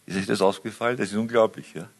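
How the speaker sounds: normal talking voice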